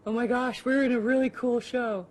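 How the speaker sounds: loud, high excited exclamation; reenacted giddy awe. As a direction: gush like an awestruck newcomer. Reenacting first awe at the set.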